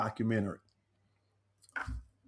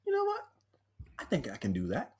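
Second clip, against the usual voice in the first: sudden idea; 5 dB quieter, faster than usual. Retold or imitated. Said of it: "and I thought".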